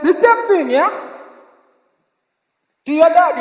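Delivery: ordinary delivery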